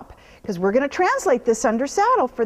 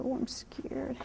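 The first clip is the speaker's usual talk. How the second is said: mock scared whisper; 13 dB quieter, slower than usual. Voicing the horse's thought.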